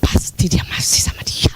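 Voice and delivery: whispered, suspicious muttering; jealous whisper. Retold or imitated. Wife whispering her jealous suspicion to herself. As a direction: hiss it to yourself in jealous doubt. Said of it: "terus kita gitu".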